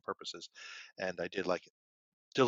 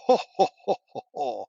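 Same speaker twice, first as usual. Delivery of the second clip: big booming ho ho ho, high and loud; booming laugh imitation; 5 semitones higher, 11 dB louder than usual. Mimicking an audience member's booming laugh. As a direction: imitate a guy's showy theatre laugh. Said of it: "we can hear his laugh".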